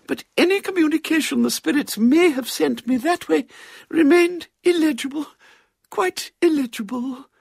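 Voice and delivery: put-on very high, affected old-lady monologue; earnest spiritualist spinster; elderly cousin. A narrator's reading. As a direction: lament your failed séances in a high voice.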